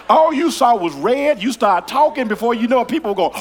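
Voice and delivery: rising preaching. Acted. Preacher building to a loud peak before the congregation. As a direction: drive it home to the congregation. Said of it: "preaching buildup".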